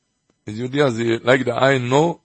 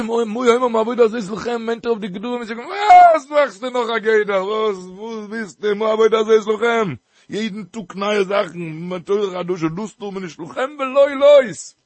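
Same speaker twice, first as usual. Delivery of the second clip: indignant outcry; 6 semitones higher than usual. Acted. Preacher voicing an objection to yet another safeguard, indignant. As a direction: cry out in outraged objection, stretching the key words.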